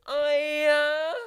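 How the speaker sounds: very high-pitched imitation yell; really high. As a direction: squeal it high, imitating a shouted reply. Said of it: "I went really high".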